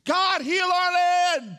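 slow, very high, loud pleading cry; crying out to God. Retold or imitated. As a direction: wail it as a desperate plea to God. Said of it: "people today are crying out".

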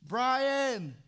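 loud high call of a name from afar. Retold or imitated. God calling out to Brian, loud high call. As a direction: call his name as if across a house.

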